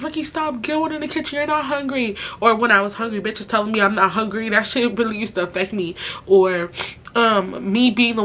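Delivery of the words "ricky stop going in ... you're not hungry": nagging relative. Retold or imitated. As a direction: imitate a relative scolding, pitched up. Family scolding her, retold.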